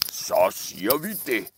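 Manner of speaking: lightly voiced cross monster, giving in; grumpy troll. Troll agreeing with the middle goat, soft grudging grump. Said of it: "svarede trolden arigt".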